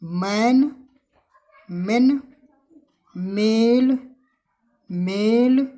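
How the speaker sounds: मैन, मेन, मेल, मे said loud and each spaced out; teacher drilling words. Shown as a demonstration. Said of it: "इसकी जो sound होगी हल्की होगी".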